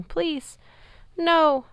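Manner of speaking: high, pleading voice; whimpering plea. Acted. Dying centaur begging the giant, high pleading. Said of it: "who was whimpering".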